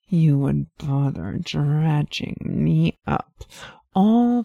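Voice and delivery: teasing, mock-disappointed demon voice; seductive demoness. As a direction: sigh it out like a bored temptress.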